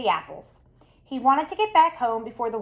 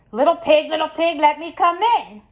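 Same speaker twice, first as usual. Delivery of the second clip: lightly voiced wolf; 5 semitones higher than usual. Wolf at the pig's door.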